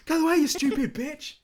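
high, loud mock honking driver; a driver stuck behind the slow ship, jeering at her; angry driver. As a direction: jeer from behind like a furious driver. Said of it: "just going".

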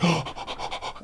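big mock gasp of amazement; imitated gasp. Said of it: "the kid's like".

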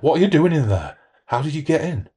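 high, loud, panicked near-scream; almost screaming. A narrator's reading. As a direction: yell it in terror. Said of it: "Almost screaming".

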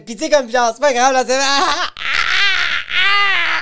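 high, loud, building into wailing laughter; mock her laughing as she talks; imitated laughing talk. Imitating the educator ending her sentence, exaggerated laughing.

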